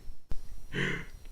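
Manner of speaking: sharp breathy gasp; delighted gasp. Acted. Mayor gasps seeing Link return, sharp gasp. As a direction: gasp at the sight of your crush.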